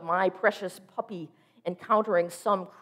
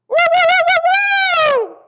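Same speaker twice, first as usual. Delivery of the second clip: very high, loud howl; imitating a dog's high, loud howl; howling dog wail; 15 dB louder, slower than usual.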